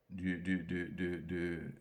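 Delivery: falling low tone. Shown as a demonstration. Teacher showing the third tone on "du", dropping low and soft. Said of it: "Troisième accent, du troisième ton".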